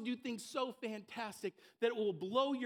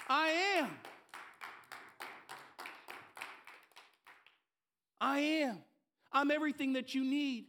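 raised, ringing declaration as God; forceful preaching; 5 semitones higher, 3 dB louder than usual. Acted. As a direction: preach it higher, stretching the key words. Preaches as God's voice at full force.

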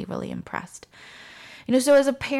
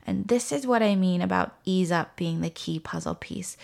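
ordinary talk, nothing marked

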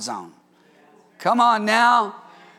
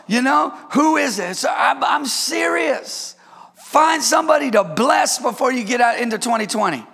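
ordinary talk

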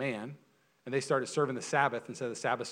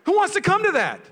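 much higher, louder challenge hurled at the room; rhetorical shout; 12 dB louder than usual. Real, not acted. Preacher to congregation, a much higher, louder shouted challenge. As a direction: cry it out to the whole congregation.

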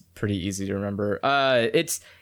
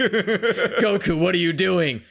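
Piccolo voice; 6 semitones higher, slower than usual. Pretend. Imitating Piccolo line.

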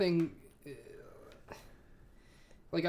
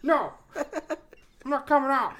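mock Tom Cruise reply; 9 semitones higher, 6 dB louder, much slower than usual.

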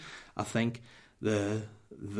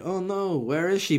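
high, loud pretend puzzlement; hammed-up parent's hide-and-seek voice; playing along; 9 semitones higher, 5 dB louder than usual. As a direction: act baffled for a hiding toddler. Plays along with hiding toddler.

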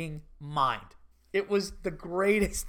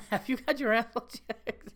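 laughing through it; 5 semitones higher than usual. Retold or imitated. Recalls the line, laughing.

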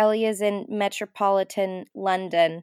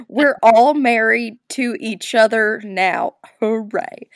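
mock announcement; 6 dB louder, slower than usual. Joins in the mock wedding announcement.

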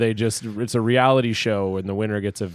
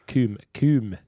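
slow Swedish accent; 2 semitones higher, 3 dB quieter, much slower than usual. Shown as a demonstration. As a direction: say Real good cum. with a Swedish accent. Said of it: "that's probably how they say it in Sweden".

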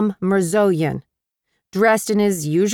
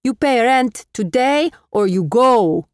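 put-on Armenian accent; 5 semitones higher than usual. A narrator's reading. Armenian landlord threatening eviction, firm Armenian accent. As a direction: lay down the law, Armenian accent.

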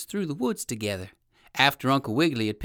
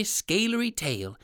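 usual speaking voice

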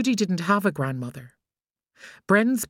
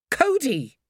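put-on sharp higher call of the boy's name; 10 semitones higher than usual. A narrator's reading. Mother calling her hiding son, sharp call. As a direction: call his name across the park.

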